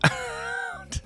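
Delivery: soft, high-pitched giggle; amused laugh. Real, not acted. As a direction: let a quiet high laugh slip out. Amused by the rolling around.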